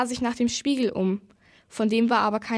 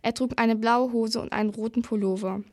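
ordinary delivery

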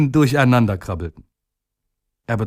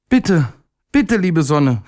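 much higher pleading voice; little day pleading; 6 semitones higher, slower than usual. A narrator's reading. Little day begging the sun to shine, high pleading voice. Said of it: "rief er".

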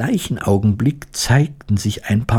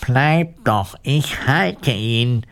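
slow, higher reassuring call; mild call; 4 semitones higher, much slower than usual. A narrator's reading. Woodcutter calling to the fleeing mice, mild drawn-out call. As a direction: call after them, stretching the end. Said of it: "rief den Mäusen zu".

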